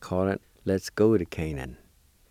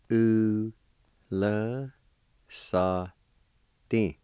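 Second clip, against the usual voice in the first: very slow, each sound held apart; syllable by syllable; much slower than usual. Shown as a demonstration. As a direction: sound out "U, la, sa, Din" with pauses between. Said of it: "It's pronounced like this".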